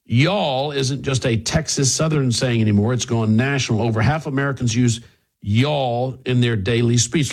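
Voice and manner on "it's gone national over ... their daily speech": ordinary talk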